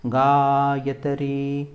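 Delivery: गायत्री with long gaa and long final ree; गायत्री drawn out on a level tone; long vowels. Shown as a demonstration. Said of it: "त्री में ई लंबा है".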